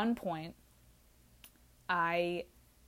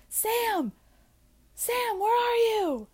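much higher, drawn-out calling; 5 dB louder, much slower than usual. Retold or imitated. Friend searching for Sam at night, calling high and long. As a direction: call her name across campus, slowly.

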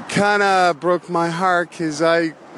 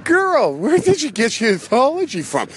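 put-on higher, incredulous whoa to the girl; mock incredulous; 8 semitones higher than usual. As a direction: pull her up short in disbelief. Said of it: "And I'm kinda going".

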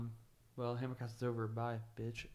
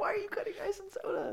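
fast, much higher, baffled voice; incredulous squeak; faster than usual. Retold or imitated. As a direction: squeal it in disbelief. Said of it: "You're just like".

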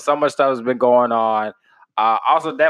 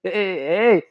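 higher, imitating his West Indian dad; West Indian accent; 8 semitones higher than usual. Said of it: "my pops, West Indian as fuck".

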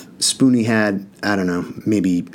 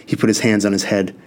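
ordinary delivery

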